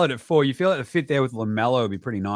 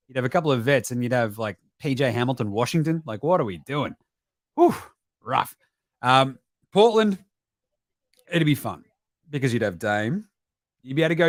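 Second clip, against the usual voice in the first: normal talking voice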